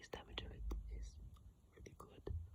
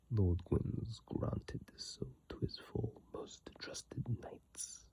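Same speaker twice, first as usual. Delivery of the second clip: slow, louder whispered reading of the item text; reading aloud in a whisper; 14 dB louder than usual. Real, not acted. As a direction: read the description solemnly in a whisper. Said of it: "Let's go".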